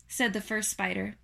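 plain reading voice

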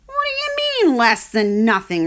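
a little pig arguing about nothing, puzzled; puzzled young pig. Acted. Wilbur voiced in story.

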